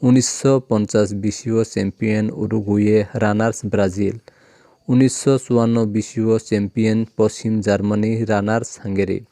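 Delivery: ordinary delivery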